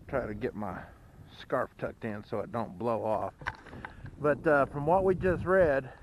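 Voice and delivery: ordinary delivery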